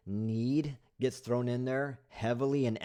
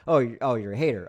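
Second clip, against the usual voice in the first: louder, quick brush-off; dismissive retort; 7 dB louder, faster than usual. Real, not acted. Brushing off a viewer who called him a hater, loud and quick. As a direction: wave off the critic with a scoff. Answering a hostile comment.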